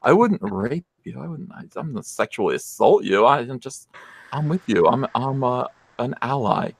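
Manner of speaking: fake-gentle male feminist lisp; mock lisp. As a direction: stammer gently, trying to seem harmless. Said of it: "So he talks like this".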